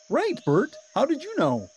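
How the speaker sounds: high, bright puppet voice for Ernie. A narrator's reading. Ernie teasing Bert, high cheerful puppet voice. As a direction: play the giddy Ernie.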